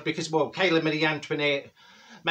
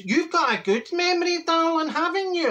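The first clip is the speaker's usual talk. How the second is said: high mocking neighbour; 12 semitones higher than usual. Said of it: "He says".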